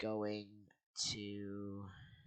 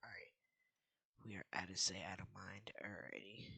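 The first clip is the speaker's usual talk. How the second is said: whispering; 6 dB quieter, faster than usual. Real, not acted. Hiding from owner.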